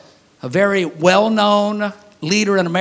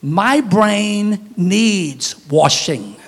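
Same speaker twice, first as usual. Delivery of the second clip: very slow, each word stretched, "my brain needs washing"; slow deadpan confession; drawn out; much slower than usual. Shown as a demonstration. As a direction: stretch it out for the punchline. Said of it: "I hate to tell you".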